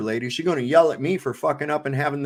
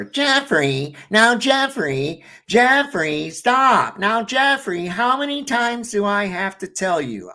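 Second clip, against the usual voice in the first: imitating a nagging mom, pitched higher and louder; Fran Drescher nag; 6 semitones higher, 4 dB louder, slower than usual. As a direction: mimic a mother whining her kid's name over and over. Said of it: "with almost a Fran Drescher type voice".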